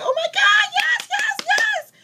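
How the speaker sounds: very high, loud squealing yes; over-excited friend. Retold or imitated. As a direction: shriek it with delight, imitating an excited friend. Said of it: "you don't have to be so excited".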